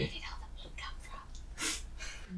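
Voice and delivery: slow, creepy whisper. Real, not acted. Player wondering where the feast meat came from, slow whisper. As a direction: hush it, slowly, as if afraid.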